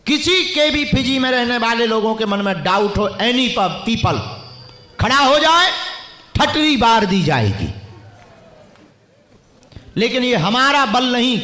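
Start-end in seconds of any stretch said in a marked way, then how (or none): 5.0-5.7 higher, louder call for doubters to stand; preacher daring any doubter in the stadium, loud challenge. Real, not acted. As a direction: dare the crowd to rise up.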